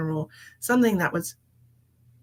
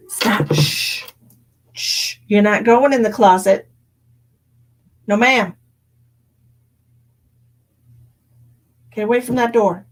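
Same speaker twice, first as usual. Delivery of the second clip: scolding shout; 9 dB louder than usual. Real, not acted. Scolding the cat off camera.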